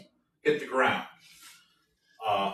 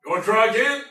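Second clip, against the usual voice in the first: higher, louder gruff sergeant; 10 semitones higher, 7 dB louder, faster than usual. Acted. Sergeant asking the player, gruff and loud. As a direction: challenge the next man. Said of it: "looks to you in the back".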